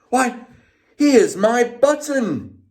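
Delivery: high, thrilled discovery; delighted cry. A narrator's reading. Bear finding his button, high delighted cry. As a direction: find the lost button with joy. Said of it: "he cried".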